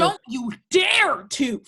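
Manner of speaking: slow, emphatic shout; angry warning. Acted. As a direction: shout it as a threat. Mother warns son in quarrel.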